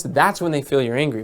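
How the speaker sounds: ordinary talk, nothing marked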